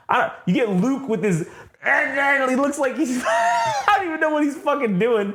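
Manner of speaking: high laughing shout. Real, not acted. Laughing at Luke's pose.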